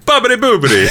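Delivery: laughing, high cartoon Italian accent; bad Italian accent. Friend quoting Sam's old bit, hammed-up Italian accent. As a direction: ham up a silly Italian accent, shouting and laughing. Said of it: "bad Italian impression".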